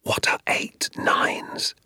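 slow, hushed classroom whisper; secretive unvoiced-breath whisper, drawn out; soft whisper. A narrator's reading. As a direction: whisper it slowly behind your hand. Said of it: "whispered very softly to me".

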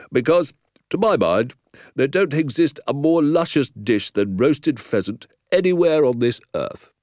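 loud, rising lament of a country sergeant; rustic police sergeant. A narrator's reading. Sergeant mourning lost roast pheasant, loudly rueful. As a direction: lament it grandly as the village sergeant.

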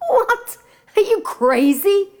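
high, sharp disbelief; can't believe what he's hearing; incredulous prince. Acted.

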